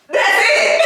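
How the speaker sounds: high laughing burst; squeaky giggle. Real, not acted. Laughing at own joke.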